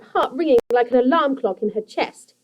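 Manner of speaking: plain reading voice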